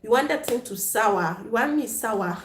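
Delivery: normal talking voice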